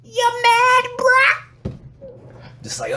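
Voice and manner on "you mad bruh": very high sing-song goading; exaggerated high-pitched needling; mocking taunt. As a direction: tease in a squeaky, stretched-out voice. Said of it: "People are gonna think you're serious. They're like".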